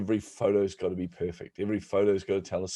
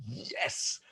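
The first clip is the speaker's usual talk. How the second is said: secret whispered cheer; inner whispered yes; 4 semitones higher, 8 dB quieter than usual. Retold or imitated. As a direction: whisper a gleeful yes to yourself. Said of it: "I was going".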